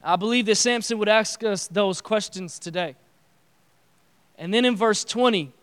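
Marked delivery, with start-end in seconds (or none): none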